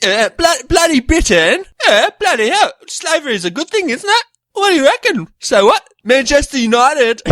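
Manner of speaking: high-pitched, loud put-on British accent; a hammed-up Brit, whining about bloody Britain; mock British accent. Mocking his British friend in a hammed-up British accent. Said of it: "You still sound Australian".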